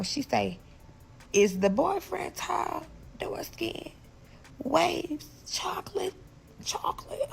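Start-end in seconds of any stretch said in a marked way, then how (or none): 1.3-7.3 high hushed whisper, slow; secret whisper. Retold or imitated. Friend describing the man beside her, hushed whisper. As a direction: whisper it so he won't hear.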